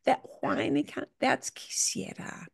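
breathy whisper of the point. Real, not acted. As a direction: whisper it like a secret. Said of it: "he does that whiny voice".

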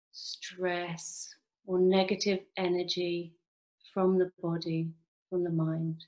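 slow meditation voice with long pauses; measured, drawn-out phrases, leaving space to breathe; guided meditation. Acted. Clinic worker leads online group through body relaxation, guided meditation.

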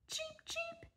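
tiny high chick peep; duckling. Acted. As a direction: squeak like a newborn chick. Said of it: "said the duckling".

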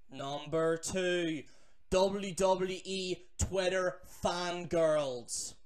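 drawn out, loud list announcer; slow countdown announcement. Acted. Countdown host announces the next entry, slow and loud. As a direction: announce number two slowly, like a countdown host. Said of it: "countdown announcement".

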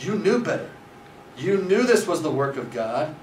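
high, emphatic reproach; acted accusation. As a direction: rebuke a man who betrayed his faith. Acted accusation turned on Copley.

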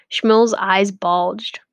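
usual speaking voice

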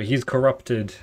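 first take, a forceful announcement; corrupted accusation. Acted. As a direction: hit it hard and firm. Villain reason voiced twice.

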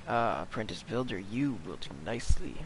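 low, soft, ominous approval; quiet otherworldly figure, pitched well down; mysterious deep voice. Acted. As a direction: sink down into a hushed deep voice. Retake in a deeper, hushed voice.

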